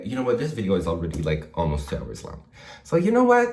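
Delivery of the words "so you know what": high, loud excited jump; sudden burst. Real, not acted. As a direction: burst in with a new idea.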